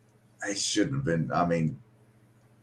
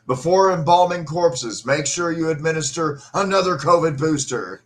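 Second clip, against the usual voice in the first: mock decree; 8 semitones higher, 6 dB louder than usual. Mourner mocking the governor's funeral rules, pompous decree. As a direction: sneer out an absurd official order. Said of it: "posted on the door".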